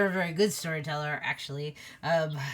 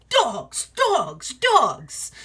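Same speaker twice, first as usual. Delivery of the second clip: higher, punchy calls dropping in pitch; excited wolves; 4 semitones higher, 6 dB louder, much slower than usual. Acted. Game master voices the listening wolves, excited chanting. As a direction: chant it like an eager pack. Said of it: "all the wolves are like".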